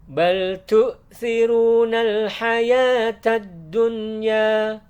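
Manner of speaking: chanted tajwid recitation, high and loud; Quran recitation. Shown as a demonstration. Teacher chants the verse for students in recitation style. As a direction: recite the verse in full melodic tartil.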